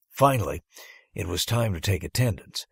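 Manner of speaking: ordinary talk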